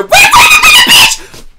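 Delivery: very high, loud outburst; piercing, startled yell; shocked screaming. Real, not acted. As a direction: shriek to stop everything. Sudden shock reaction to the video.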